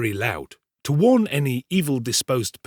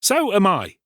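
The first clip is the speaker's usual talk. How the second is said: high, louder piping-up; suddenly awake tinker; 9 semitones higher, 5 dB louder than usual. A narrator's reading. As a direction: chime in high, as if just woken. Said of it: "waking up as suddenly as he had fallen asleep".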